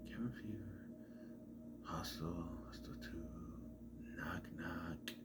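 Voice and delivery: barely audible, low murmur while thinking; near-whispered recall, trailing to yourself; muttering to self. Real, not acted. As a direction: run through a list under your breath. Thinking aloud, recalling film titles.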